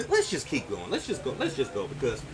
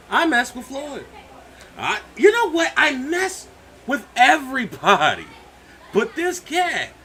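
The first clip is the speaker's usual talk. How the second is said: loud; 10 semitones higher, 8 dB louder than usual. Real, not acted. Heated boasting about who he messed with.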